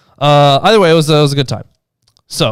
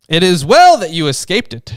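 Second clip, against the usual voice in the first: lightly voiced old lady, higher pitched; a hint of an old lady, pitched up; 6 semitones higher, 4 dB louder than usual. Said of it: "can't exactly do the old lady voice".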